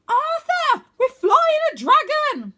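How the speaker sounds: very high, loud, slow delighted shout; boy thrilled to fly a dragon. Acted. Wynne thrilled.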